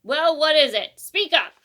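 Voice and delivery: cranky owl shouting; scratchy old owl. A narrator's reading. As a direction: bellow like a deaf old bird woken up. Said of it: "said in a scratchy voice".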